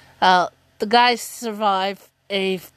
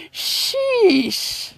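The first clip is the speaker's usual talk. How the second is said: high, laughing sheesh; exasperated sheesh; 11 semitones higher than usual. Retold or imitated. Fan retelling her reaction to the quiz, exasperated. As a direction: toss it off, half laughing. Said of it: "I was like".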